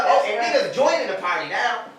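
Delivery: ordinary talk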